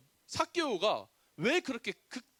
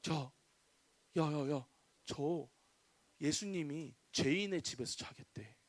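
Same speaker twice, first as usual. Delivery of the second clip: hushed, lowered voice of a gossiping crowd; murmuring gossip; 7 semitones lower, 6 dB quieter than usual. Acted. Townspeople gossip about Jesus lodging with a sinner, hushed murmuring. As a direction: pass it on under your breath, low and soft. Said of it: "사람들이 수군대기 시작했어요".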